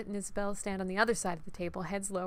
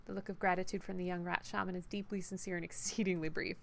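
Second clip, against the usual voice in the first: ordinary delivery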